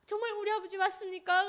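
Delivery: high, loud, stunned question; disbelieving cry. Retold or imitated. Daughter calling up to her father, stunned. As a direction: cry out in disbelief, can't believe it's him.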